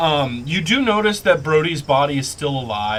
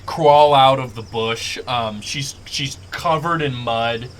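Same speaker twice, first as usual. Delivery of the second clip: ordinary talk, nothing marked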